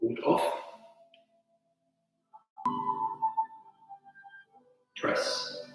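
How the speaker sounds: soft, sparse form cues over music; name each move quietly as you flow; calling out moves. Acted. Calls tai chi moves during form.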